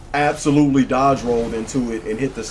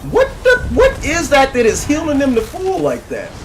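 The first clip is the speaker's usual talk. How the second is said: very high, loud squeal of disbelief; shocked yelling; 9 dB louder than usual. Real, not acted. Player seeing enemies healed to full, shocked yelling. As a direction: screech in astonishment.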